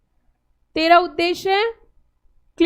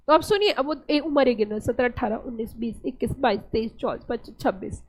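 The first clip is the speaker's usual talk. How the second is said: softer, trailing count of ages; quiet playful counting; 7 semitones lower, 8 dB quieter than usual. Real, not acted. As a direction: count under your breath in jest.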